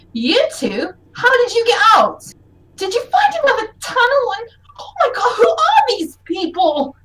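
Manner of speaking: high, startled rush of questions; acted alarm, pitch jumping up; gasping surprise. Elf finding escaped children with strangers, gasping surprise. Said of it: "and she gasps".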